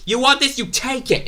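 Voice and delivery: very high, strained mock outburst; high-pitched retold angry taunt; imitated fury. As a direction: act out a furious wrestler's challenge, pitch shooting up. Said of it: "he was furious".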